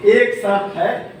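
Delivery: slow, deliberate condition; cat's condition. Acted. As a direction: lay down the terms slowly. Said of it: "बिल्ली बोली". (धीरे से शर्त रखना)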